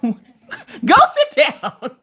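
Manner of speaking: slow, laughing shoo; laughing dismissal. Real, not acted. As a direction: tell them to sit, cracking up. Shooing nosy person, laughing.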